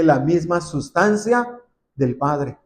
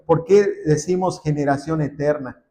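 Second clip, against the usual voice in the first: ordinary delivery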